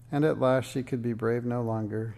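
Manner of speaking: plain reading voice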